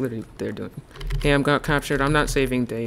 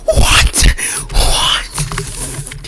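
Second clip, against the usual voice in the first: loud breathy disbelief; shocked shout; 6 dB louder than usual. Real, not acted. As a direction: yell in outrage. Hit through the wall.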